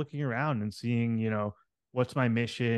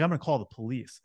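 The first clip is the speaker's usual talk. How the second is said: normal talking voice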